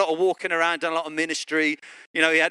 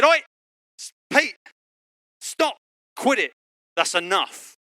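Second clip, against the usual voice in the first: high, halting mock scolding; comic telling-off; 5 semitones higher, 3 dB louder than usual. Jesus stopping Peter after the ear cut, comic telling-off. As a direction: break it into sharp, high calls.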